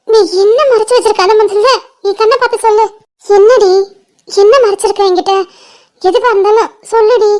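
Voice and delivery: usual speaking voice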